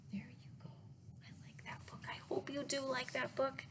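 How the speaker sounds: quiet, intimate whisper; soft whisper. Real, not acted. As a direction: murmur it close. Quiet closing remark.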